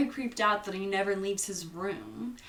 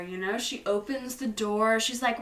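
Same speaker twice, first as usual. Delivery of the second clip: usual speaking voice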